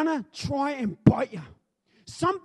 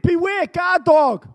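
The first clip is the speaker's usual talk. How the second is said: loud sign reading; 3 semitones higher, 9 dB louder, slower than usual. Acted. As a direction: read the sign out loud, slowly.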